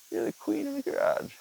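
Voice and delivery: high cooing pet voice; high pet talk; talking to the cat. Real, not acted. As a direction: praise the cat in a squeaky coo. Baby-talking to his cat.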